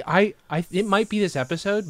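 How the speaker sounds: normal talking voice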